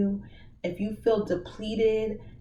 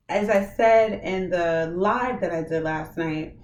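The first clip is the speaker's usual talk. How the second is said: ordinary talk, nothing marked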